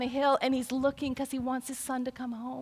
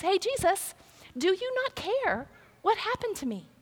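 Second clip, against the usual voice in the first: higher, pleading mock complaint; frustrated complaint; 7 semitones higher than usual. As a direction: whine it at Jesus like you're fed up. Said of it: "she's a little frustrated".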